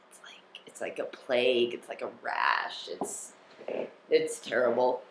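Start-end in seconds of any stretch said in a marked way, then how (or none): none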